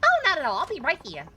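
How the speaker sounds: put-on high New York accent, brash; a brassy New York lady warning him. Passenger warns the driver, high New York accent. Voices female taxi passenger.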